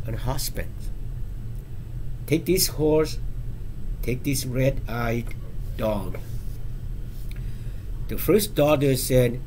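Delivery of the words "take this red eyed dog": ordinary delivery, nothing marked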